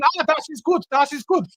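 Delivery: high-pitched mock German voice. German officer content at home, mock German voice. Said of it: "that German dickhead".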